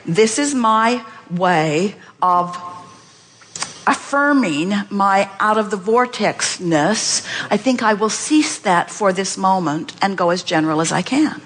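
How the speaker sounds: slow, deliberate self-talk; a slow measured statement; slow affirmation. Shown as a demonstration. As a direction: spell it out slowly to yourself. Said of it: "stop and say".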